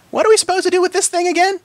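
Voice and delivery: put-on high puzzled little-girl voice; squeaky quizzical pony; puzzled filly. A narrator's reading. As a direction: ask it like a confused young filly.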